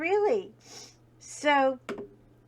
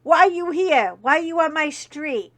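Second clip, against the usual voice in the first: higher, louder tough New York cop yelling; Joe Pesci cop; 5 semitones higher, 9 dB louder than usual. Retold or imitated. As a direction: bark like a hot-headed Joe Pesci cop. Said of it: "He's like, Joe Pesci".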